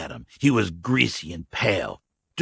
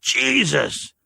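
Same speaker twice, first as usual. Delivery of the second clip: sighing; 14 semitones higher than usual. Acted. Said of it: "the old man sighed".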